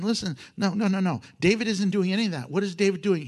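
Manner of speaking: ordinary talk, nothing marked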